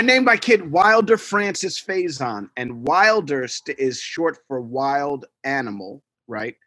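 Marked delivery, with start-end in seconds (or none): none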